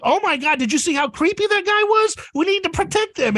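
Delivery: high-pitched mock concern; alarmed onlooker. Onlookers reacting to the creepy screaming guy, high mock alarm. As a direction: play a shocked, protective bystander.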